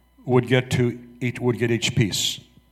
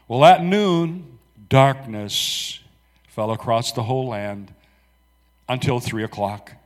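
ordinary talk

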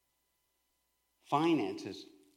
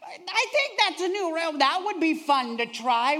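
higher, drawn-out hinting voice; mock teen fishing for food; hinting teenager; 8 semitones higher, 4 dB louder than usual. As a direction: circle around without asking. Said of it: "driving down the road".

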